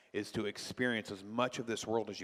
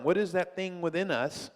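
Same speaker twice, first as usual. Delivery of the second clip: normal talking voice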